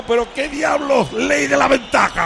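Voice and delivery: exasperated protest. Retold or imitated. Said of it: "Y yo digo".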